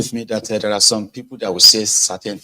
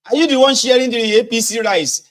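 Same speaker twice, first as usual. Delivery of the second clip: much higher, worked-up yelling; panicked accusing voter; 10 semitones higher, 4 dB louder than usual. Retold or imitated. Voter confronting the rice sharer, high and agitated. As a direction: cry out at the rice sharer in alarm. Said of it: "They say".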